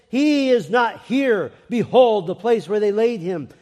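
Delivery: loud, high, stretched "He is not here"; emphatic proclamation. A narrator's reading. Angel announces the greatest event in history, proclaimed loudly. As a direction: proclaim it at full force, hitting "Behold".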